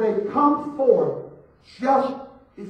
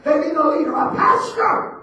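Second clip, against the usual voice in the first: building emphasis; 6 semitones higher, 5 dB louder than usual. Real, not acted. Preacher building to a point.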